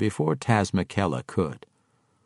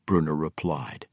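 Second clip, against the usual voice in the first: usual speaking voice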